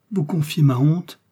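high, loud, scandalised exclamation; shamed outcry. A narrator's reading. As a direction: cry out in wounded modesty. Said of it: "s'écria Milady avec le rouge de la pudeur".

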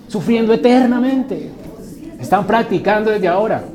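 voz alta y aguda de advertencia; prédica enfática. Acted. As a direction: martilla la sentencia ante la congregación. Predica a plena voz. (clamor de predicador)

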